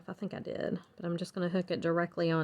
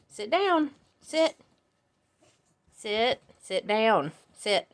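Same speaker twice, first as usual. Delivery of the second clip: dog commands; 3 dB louder, slower than usual. Real, not acted. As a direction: tell the dog to sit.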